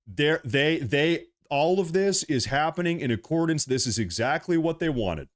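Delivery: usual speaking voice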